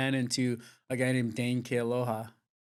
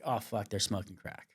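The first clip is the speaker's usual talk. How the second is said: hushed dismay; 4 semitones lower, 8 dB quieter than usual. Retold or imitated. Retold secret dismayed realization.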